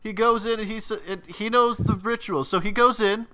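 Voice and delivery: high, rushed, nervous explanation; spilling it out to save himself; frightened confession. Acted. Fred babbling his secret to the interrogator, high nervous voice.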